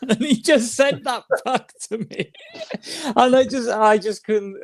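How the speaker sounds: real laughter pushing the voice high; laughing while talking. Real, not acted. Cracking up telling the story.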